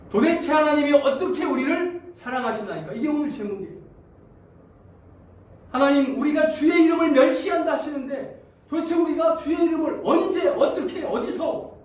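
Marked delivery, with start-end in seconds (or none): 0.1-2.9 high, loud, demanding question; indignant protest to God. Acted. Israelites protesting to God, high indignant demand. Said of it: "이렇게 따져야". 5.7-11.7 high, loud accusing shout at God; indignant shout. Acted. Israelites challenging God's charge, high indignant shout. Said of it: "이렇게 따집니다".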